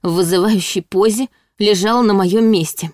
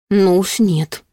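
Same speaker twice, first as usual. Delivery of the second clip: ordinary delivery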